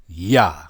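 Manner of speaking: "Ya" as one isolated syllable, pitched low; "Ya" with an open ah; pronunciation demo. Shown as a demonstration. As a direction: sound out "Ya" for learners. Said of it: "How is it pronounced?".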